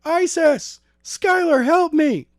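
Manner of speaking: very high, loud desperate scream; screaming for help. A narrator's reading. Isis dragged away, calling Skylar, high scream. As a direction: shriek for help as she is dragged off.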